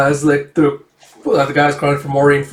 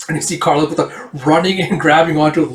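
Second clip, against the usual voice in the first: usual speaking voice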